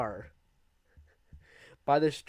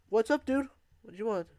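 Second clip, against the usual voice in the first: cocky taunt; 10 semitones higher than usual. Retold or imitated. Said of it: "And I was like".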